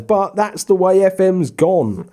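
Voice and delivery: ordinary talk, nothing marked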